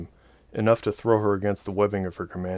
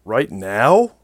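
startled, louder question; surprised; 2 semitones higher, 9 dB louder than usual. A narrator's reading. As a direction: caught off guard, blurting it. Startled at sudden liftoff.

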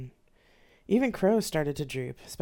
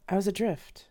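ordinary talk

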